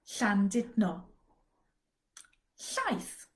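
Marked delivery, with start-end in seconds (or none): 0.1-1.0 Llandudno slow, ll as a hissed breathy l; slow Welsh ll. Shown as a demonstration. Teacher demonstrating the Welsh double L slowly. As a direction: Llandudno with the tongue hissing air at the sides. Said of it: "I'm gonna say a series of words with L".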